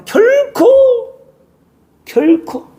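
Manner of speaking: high, sharp cry of never; Satan insisting. Acted. Satan insisting to Eve, high and sure. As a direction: shoot the word up high with certainty. Preacher voices Satan's certainty.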